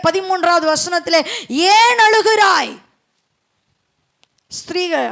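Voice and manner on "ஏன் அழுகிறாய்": slow, raised-pitch question, lightly acted; angels' question. Angels asking weeping Mary at the tomb, slow lifted question. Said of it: "அங்க தூதர்கள் கேட்கிறாங்க மரியாளை பார்த்து".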